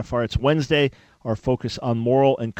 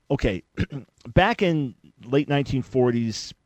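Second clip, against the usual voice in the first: normal talking voice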